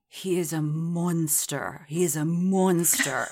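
slow, lightly Canadian old father, mock grave; Canadian accent. Imitating Donald Sutherland's line to Grace, slow Canadian accent. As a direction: drawl "monster" in a mild Canadian accent. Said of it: "And he goes".

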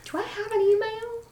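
high, lightly voiced bewildered old lady; confused old lady. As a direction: ask it like a puzzled grandma. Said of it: "In your head you hear".

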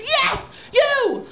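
high, exasperated holler; imitated warden yelling, pitch way up; pissed-off shout. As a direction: bellow it like an angry warden. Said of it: "and he's pissed".

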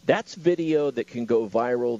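ordinary talk, nothing marked

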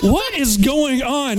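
high, slow, clueless whine; dumb marshal. Retold or imitated. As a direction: play the baffled idiot deputy, slowed right down. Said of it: "dumbest fucking marshal ... being like".

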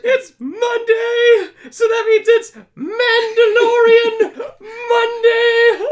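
drawn-out, high, loud cheer; excited shout. Real, not acted. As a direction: belt out the catchphrase. Excited podcast catchphrase shout.

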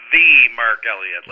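"The" said as THEE, drawn out; "The" with a long E, leaned on. Shown as a demonstration. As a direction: stretch "The" into a slow, stressed "thee". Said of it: "Everyone pronounces the E".

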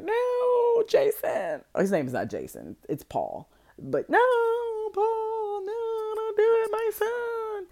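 shrill high mock wail, hammed-up; mocking the mother's limp protest in a high wail; mock damsel. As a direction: squeak it out like a helpless movie damsel. Mocking the mom's weak protest.

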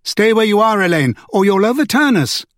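high urgent shout. A narrator's reading. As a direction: shout a warning across the boat. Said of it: "Kate shouted".

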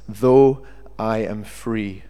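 Though I am free drawn out slowly, each word weighed; slow emphasis. Shown as a demonstration. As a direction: lean on Though and let it fall. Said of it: "I want us to focus on that".

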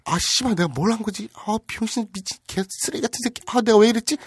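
high-pitched self-reproach; hammed-up remorseful muttering; acted regret. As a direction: berate yourself in a high, anguished inner voice. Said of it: "막 이렇게 후회하고 있는데".

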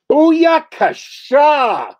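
very high, loud, stretched-out whoop; rally the troops with a silly war cry; leading the Pulp Revolution into battle with a whoop; mock battle cry. Battle cry for gang fight.

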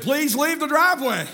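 high, imitated order from an unseen guard; commanding voice. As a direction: mimic a stern warning to trespassers. Mimics unseen voice ordering them away.

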